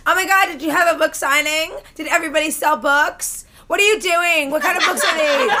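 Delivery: campy, over-eager questions; lightly mocking gush of an excited stranger; flamboyant gushing man. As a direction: imitate a nosy flamboyant drunk. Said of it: "this guy walks in and he's like".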